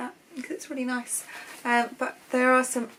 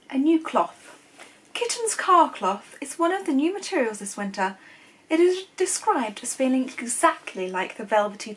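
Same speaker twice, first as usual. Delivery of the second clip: hammed-up posh accent reading out old magazine copy; posh, measured reading-aloud voice; 3 semitones higher than usual. Shown as a demonstration. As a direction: read it in a very posh English accent. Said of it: "I did such a posh accent for that".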